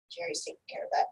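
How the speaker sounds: breathy whisper under the breath; whispered aside. Acted. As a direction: whisper it to yourself. Repeats line, second time whispered.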